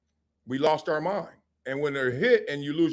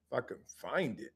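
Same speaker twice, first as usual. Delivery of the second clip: normal talking voice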